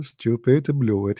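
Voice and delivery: normal talking voice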